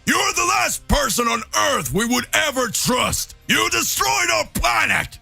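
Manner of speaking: furious shout. Acted. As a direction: spit out every accusation at Frieza. Vegeta rages at Frieza.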